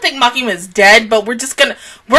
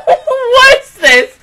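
shrieking laugh; 10 semitones higher than usual. Real, not acted. Laughing at the episode.

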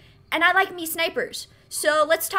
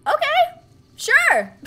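surprised agreement; 12 semitones higher, 4 dB louder, much slower than usual. Real, not acted. Reacting to revenge quest.

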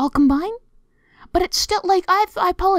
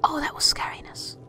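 soft, voiceless shudder; hushed whisper; 7 dB quieter than usual. Real, not acted. Player remembering the scary chapter, whispering. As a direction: breathe it out like a shiver.

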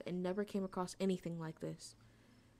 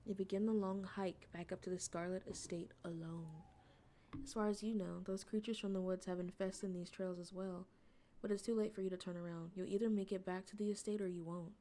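story narration; 4 dB quieter than usual. Acted. Reading the game's narration to viewers, softly. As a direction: set the lonely night hike scene.

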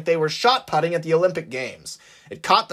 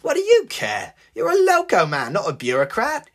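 higher, incredulous jab; scornful retort; 7 semitones higher than usual. Acted. Maxine scoffing at the diesel man, higher. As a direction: scoff at his answer.

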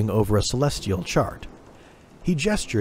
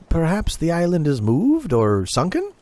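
a hint of a higher eager apprentice; young navigator wondering aloud; lightly raised youthful voice, questioning; lightly voiced young sailor; 6 semitones higher than usual. A narrator's reading. Tumult voiced.

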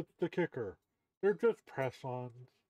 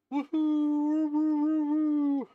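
cheering; 11 semitones higher, 7 dB louder, slower than usual. Real, not acted. Cheering after finally going live.

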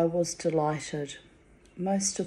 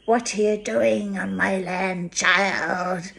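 lightly imitated Scottish scold, a bit louder; a mild mock Scottish crone; Scottish old woman; 3 semitones higher, 5 dB louder, slower than usual. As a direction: pretend to be old Missus Walker. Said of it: "so I too could go".

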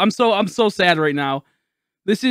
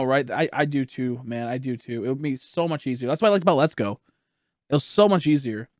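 ordinary delivery

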